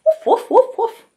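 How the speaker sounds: put-on high, playful woof woof for the kids; dog barking. Teacher answering what a dog says, playful barks. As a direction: bark like a friendly puppy. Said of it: "What does a dog say?".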